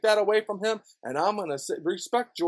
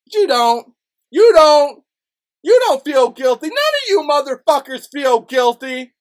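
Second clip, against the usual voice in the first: loud, higher accusing yell; angry shout; 6 semitones higher, 7 dB louder than usual. Real, not acted. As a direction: punch you at them hard. Accusing the officers.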